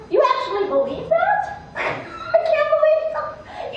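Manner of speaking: high-pitched, laughing incredulity; mocking, squeaked-up disbelief; scoffing disbelief. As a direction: laugh at the man's naivety. Dog scoffs at the man.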